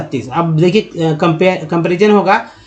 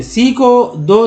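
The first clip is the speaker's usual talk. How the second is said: slow spelled letter; 3 semitones higher, much slower than usual. Shown as a demonstration. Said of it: "उच्चारण क्या होता है इस बात पे फोकस करेंगे".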